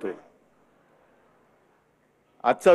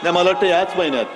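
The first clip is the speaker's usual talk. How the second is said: marathi rally speech. Real, not acted. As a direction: address the crowd from the stage. Opening address to rally crowd.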